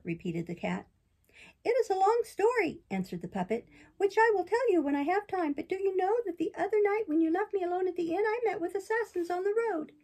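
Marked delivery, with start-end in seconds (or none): 1.6-2.8 high, bright-eyed puppet, much higher than the narrator; puppet boy voice. Acted. Pinocchio protests to the fairy in a high boyish voice. 4.0-9.9 high, bright-eyed puppet, much higher than the narrator; puppet boy voice. Acted. Pinocchio protests to the fairy in a high boyish voice.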